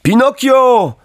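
put-on high, loud boyish call. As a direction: call to a friend across the cart. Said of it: "zawołał Knot".

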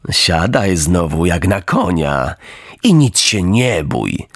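low, slow and syrupy; oily coachman. Acted. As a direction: wheedle the puppet sweetly. Coachman voiced.

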